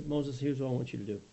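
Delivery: patient God explaining a plan to Moses; God gently tells Moses to throw the tree in; voice of the Lord. Acted. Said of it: "And the Lord says".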